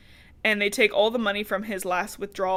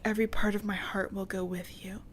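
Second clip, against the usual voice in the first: mock tender whisper; 6 dB quieter than usual. Voicing Sarah's farewell line.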